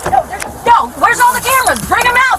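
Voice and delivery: angry yelling. Real, not acted. Yelling for the cameras.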